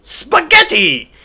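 high, drawn-out puppet "Spaghetti"; mock Grover voice. Grover giving his word for boy, high mock puppet voice. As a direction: announce it proudly in a high Muppet voice. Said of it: "He's like".

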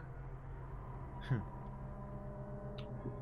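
put-on breathy wicked old-hag cackle; hag laugh. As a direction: cackle like a witch. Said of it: "I gave you a hag laugh".

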